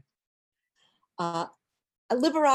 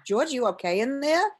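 higher, louder retold call; raised voice, lifting into a question; checking through the door; 7 semitones higher, 5 dB louder than usual. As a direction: call in with mild concern. Calls to George through door.

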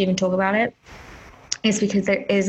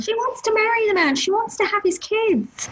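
impassioned; 12 semitones higher than usual. Real, not acted. Outraged defence of Britney.

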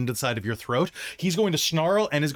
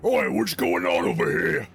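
gruff draconian voice; 8 semitones lower than usual. Acted.

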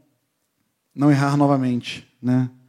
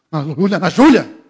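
calling out; 2 semitones higher, 6 dB louder than usual. Retold or imitated. Acordando a filha aos gritos.

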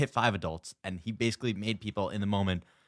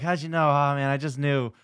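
raised, cocky reply; smug TV hero; 6 semitones higher, 5 dB louder than usual. Acted. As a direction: play the smug winner. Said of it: "Or he's like".

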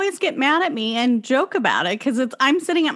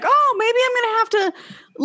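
dad impression; 12 semitones higher than usual. Dad's reaction, acted out.

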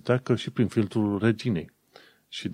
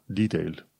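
DEE-tail, "detail" stressed at the front; British first-syllable stress; 2 semitones lower than usual. Shown as a demonstration. Teacher showing how Britons say "detail", front stress. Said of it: "britanicii pun accentul pe prima silabă".

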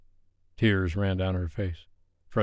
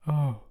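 quivery sigh; 6 semitones higher than usual. A narrator's reading. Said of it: "The quivery sigh escaped".

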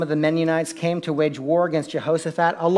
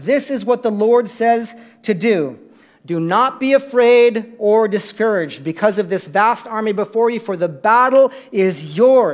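loud, raised scripture declamation; prophetic proclamation; 5 semitones higher, 7 dB louder than usual. Acted. Prophet tells king and people not to fear, ringing proclamation. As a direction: proclaim it to a whole assembly, louder and higher.

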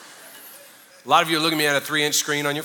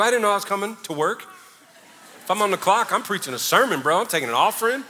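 higher, teasing protest; mock indignant joke; 6 semitones higher than usual. Pastor answering coworkers with a mock indignant protest. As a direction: playfully fake outrage.

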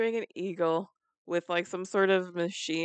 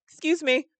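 higher-pitched "excuse me"; acted polite request; 9 semitones higher than usual. Asking people to move, higher-pitched polite request. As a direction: reenact a pointed little pardon.

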